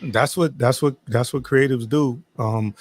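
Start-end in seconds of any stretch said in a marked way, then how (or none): none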